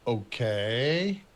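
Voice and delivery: drawn-out, rising "okay"; mock hesitant agreement; imitating skeptical assent. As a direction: stretch it out and lift it into a doubtful question. Bosses' reaction to an odd plan.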